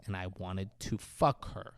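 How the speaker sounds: low, soft villain dictation; feed the line quietly and low; low prompting. Retold or imitated. Villain feeds Stu his confession, low and quiet.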